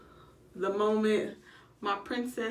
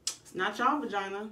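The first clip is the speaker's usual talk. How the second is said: ordinary talk, nothing marked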